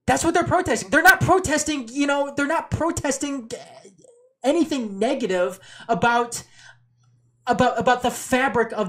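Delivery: high-pitched, emphatic repetition; impassioned insistence. Real, not acted. Insisting in a heated argument.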